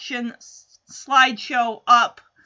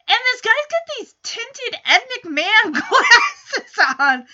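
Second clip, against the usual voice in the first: laughing; 9 semitones higher, 5 dB louder than usual. Real, not acted. Laughing at the tinted glasses.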